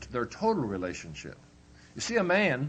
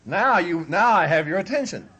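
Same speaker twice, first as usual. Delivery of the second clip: wife complaining; 4 semitones higher, 7 dB louder than usual. Retold or imitated. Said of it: "she'll say".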